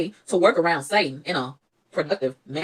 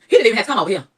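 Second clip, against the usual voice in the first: louder, incredulous first take; louder mock disbelief; indignant quote; 4 semitones higher, 6 dB louder, faster than usual. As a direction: scoff at him showing up, voice up. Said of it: "I said".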